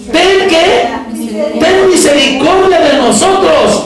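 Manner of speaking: high, drawn-out plea for mercy; crying out. Acted. Blind men crying after Jesus for mercy, high preached cry. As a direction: call out like the blind men begging. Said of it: "dando voces, o sea, dando gritos".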